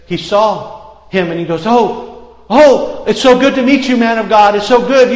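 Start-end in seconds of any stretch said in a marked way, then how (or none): 1.7-5.1 higher and louder, gushing over the man of God; hammed-up excited welcome; eager greeting. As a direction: gush with delight at meeting him.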